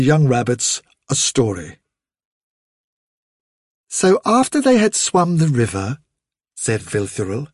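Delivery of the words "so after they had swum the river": higher-pitched mother's storytelling voice; lilting, higher storyteller; mother rabbit storytelling. Acted. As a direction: tell a tale to little ones in a higher voice. Vilthuril tells a story.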